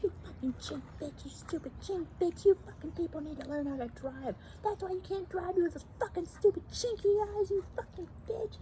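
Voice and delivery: high, strained imitation of a ranting woman; mock yelling driver. Retelling the truck driver's tirade in a high mock voice. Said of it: "She's like".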